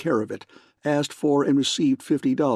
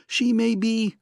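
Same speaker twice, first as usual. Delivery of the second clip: high, urgent and cut off; frantic, interrupted; much higher, pleading; upset; 10 semitones higher than usual. A narrator's reading. Angry woman cut off.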